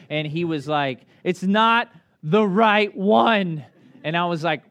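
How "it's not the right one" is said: put-on higher, louder, very slow wail of a child; dad imitating his crying son; whiny child. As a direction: drag it out like an upset kid. Son Whit melting down.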